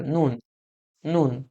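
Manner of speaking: "নুন" just like the Bengali word for salt; teacher modelling "নুন" with a plain Bengali n; simple everyday pronunciation. Shown as a demonstration. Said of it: "আমরা বাংলা ভাষায় লবণকে নুন বলে থাকি".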